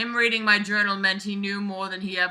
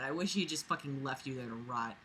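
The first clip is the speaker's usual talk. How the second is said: much softer under-the-breath remark; muttered aside; 7 semitones lower, 13 dB quieter, faster than usual. Real, not acted. Reader muttering a spiteful aside at the writer. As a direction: mutter it bitterly to yourself.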